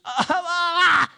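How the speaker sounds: loud, very high, drawn-out mock wails; imitated uncontrolled wailing. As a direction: mimic her crying out of control. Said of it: "they can't get control of her".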